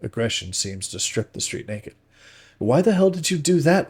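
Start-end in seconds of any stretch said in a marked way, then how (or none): 2.6-3.9 much higher, louder furious shout; angry, near-screamed demand; loud again. A narrator's reading. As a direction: yell it at your friend. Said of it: "I become loud again".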